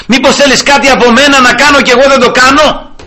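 high, loud desperate plea to God; pleading prayer. Retold or imitated. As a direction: cry out the question to the Lord. (απελπισμένη ικεσία, ψηλά και δυνατά)

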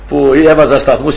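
ordinary talk

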